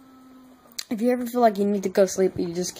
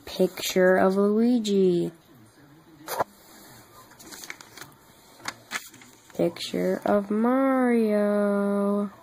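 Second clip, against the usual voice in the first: slow presenting; much slower than usual. Real, not acted.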